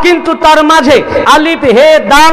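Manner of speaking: fervent preaching. Acted. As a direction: preach it out to the gathering, building fervour. Preacher back at full force.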